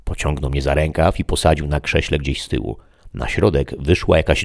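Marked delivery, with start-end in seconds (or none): none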